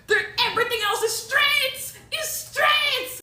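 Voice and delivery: high, loud cry of frustration; exasperated outburst. Real, not acted. Client showing her curls all fell straight, exasperated wail.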